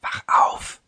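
soft hushed whisper. A narrator's reading. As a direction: breathe it so no one wakes. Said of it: "flüsterte er".

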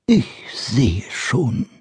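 very slow, breathless shaken whisper. Acted. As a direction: whisper it, still scared. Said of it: "sagte er dann".

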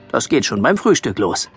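plain reading voice

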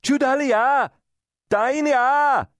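high-pitched, loud jeering cry; taunting shout. A narrator's reading. Villagers jeering at a woman they take for a witch, taunting shout. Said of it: "shout taunts at her".

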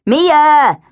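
loud, high call for attention. A narrator's reading. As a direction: shout her name to snap her out of it. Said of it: "said Ella loudly".

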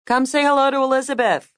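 mother calling to someone upstairs; yelled. A narrator's reading. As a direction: holler it up through the house. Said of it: "Zoe yelled, craning her chin skyward".

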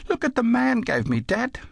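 put-on very high-pitched little kid's voice; small child. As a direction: pipe up high as a child talking to Dad. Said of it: "The kid dawdled out".

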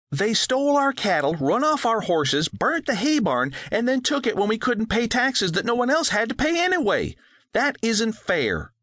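high, wailing complaint; a hurt child listing wrongs; upset girl. A narrator's reading. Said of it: "she wailed".

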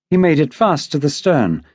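ordinary delivery

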